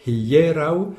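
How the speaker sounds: Hieraŭ, three syllables, lean on the E; stress on the middle syllable. Shown as a demonstration. Teacher modelling Esperanto word stress for the class. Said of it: "attention à l'accent tonique".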